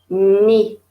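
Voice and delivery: "Knee" said loud as "nee" with no K sound; silent K. Shown as a demonstration. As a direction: punch "Knee" but keep the K silent. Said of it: "un K qui ne se prononce pas". ("Knee" fort, sans prononcer le K)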